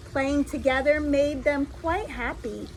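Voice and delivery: usual speaking voice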